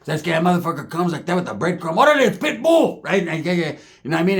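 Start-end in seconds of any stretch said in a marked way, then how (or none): 1.9-3.0 higher, louder announcer burst; mock hype. Naming the cockroach like the rapper, mock hype. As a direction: hype the name up.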